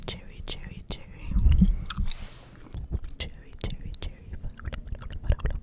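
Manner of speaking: whispered jelly and strawberry repeated; ASMR trigger words, rhythmic. Shown as a demonstration. (تكرار كلمات همسًا)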